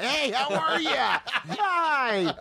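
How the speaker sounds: high, drawn-out fake-friendly greeting; phony nice guy. Hollywood phony greeting someone he doesn't know, gushing. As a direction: gush it high and slow, all phony. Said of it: "Hollywood phony nice guy".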